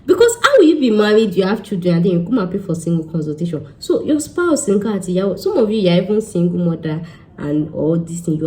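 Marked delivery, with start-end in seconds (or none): none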